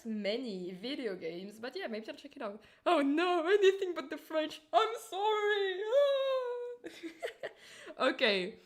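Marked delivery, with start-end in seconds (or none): 2.9-6.9 mock despair. Mock horror at French spy. 6.9-7.9 wailing laugh. Real, not acted. Laugh after mock wail.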